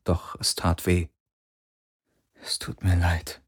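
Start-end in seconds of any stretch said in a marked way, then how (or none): 2.4-3.4 hushed, near-whispered inner apology; gedachte Entschuldigung. A narrator's reading. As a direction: apologize under your breath, softly. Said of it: "dachte er". (leise geflüsterte Entschuldigung in Gedanken)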